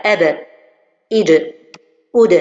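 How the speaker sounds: "ab", "id", "oud" with the end consonant released quickly and sharply; crisp bounced final consonant; correct qalqala. Shown as a demonstration. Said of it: "Voilà, rapidement, je détache".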